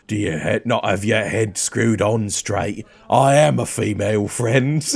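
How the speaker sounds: indignant dwarf warrior; put-on dwarf accent, taking mild offence; Hildreth. Dwarf correcting a traveller about being female, accented voice.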